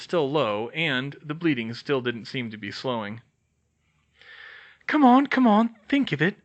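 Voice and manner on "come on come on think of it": high, loud, pressed urging; urgent self-urging. Acted. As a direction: push yourself to think under pressure. Heroine urging herself to think.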